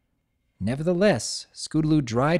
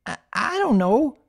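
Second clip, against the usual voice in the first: nervous stammer; 9 semitones higher than usual. Acted. Scootaloo stammers, scared.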